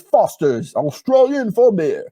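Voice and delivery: hammed-up Australian commercial tagline; put-on Australian twang for a slogan; Australian accent. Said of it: "that's my Australian accent".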